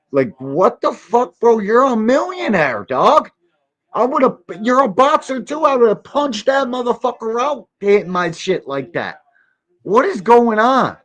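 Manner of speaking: high, incredulous mock rant; mocking outraged voice. Ranting about the bad fade.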